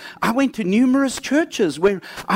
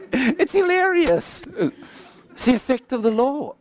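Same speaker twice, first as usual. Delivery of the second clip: laughing; 6 semitones higher than usual. Real, not acted. Still laughing at the memory.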